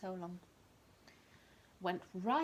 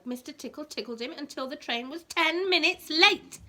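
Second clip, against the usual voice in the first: louder and higher, rising to the end; excited build; 6 semitones higher, 10 dB louder than usual. A narrator's reading. Reading to a child, a gleeful loud climax. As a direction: build up to a big rising finish.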